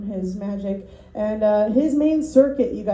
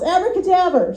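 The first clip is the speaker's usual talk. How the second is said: higher, louder magician's cry; mock yell; 7 semitones higher, 4 dB louder than usual. Magician finishing graveside coffin saw trick, mock yell. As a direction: announce it like a stage magician. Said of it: "and yells".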